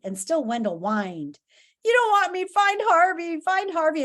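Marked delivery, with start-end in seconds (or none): 1.9-4.0 high, loud tearful whining; acted sobbing, high-pitched plea; sobbing whine. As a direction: wail it, begging to be spared. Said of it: "he sobbed".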